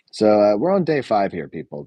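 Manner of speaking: usual speaking voice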